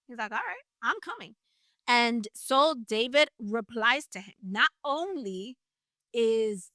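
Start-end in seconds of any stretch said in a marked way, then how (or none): 0.3-1.3 light, high-pitched, casual retold reply; unfazed. Young David answering the giant's threats, lightly unfazed. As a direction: imitate a kid who shrugs off a threat. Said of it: "was not fazed".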